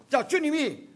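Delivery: usual speaking voice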